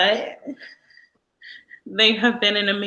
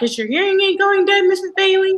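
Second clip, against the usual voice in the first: put-on high, louder child's voice; perky young student voice, pitched up; kids asking; 12 semitones higher, 5 dB louder than usual. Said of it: "they're like".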